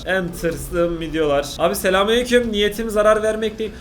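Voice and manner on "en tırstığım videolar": slow drawn-out repeat. Real, not acted. Repeats the title slowly.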